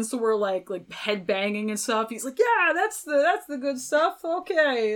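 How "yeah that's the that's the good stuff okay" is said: higher, louder pumped-up Triple H voice. Retold or imitated. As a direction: do your hyped Triple H. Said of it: "That's my Triple H voice".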